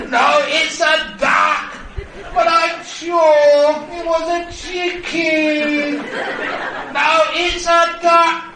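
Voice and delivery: very high, loud, drawn-out comic yelling; quarrelling shout. Acted. Married couple yelling over duck or chicken, quarrelling shout. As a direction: shout it slowly, stretching every key word. Acted shouting match, spelling it out.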